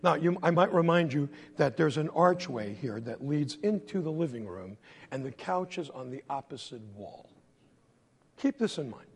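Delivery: ordinary delivery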